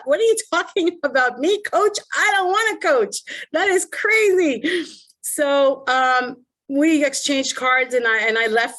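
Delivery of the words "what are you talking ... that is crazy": retelling her shock at being asked to coach, high and incredulous. Retold or imitated. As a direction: act floored by the suggestion. Retold shock at coaching offer.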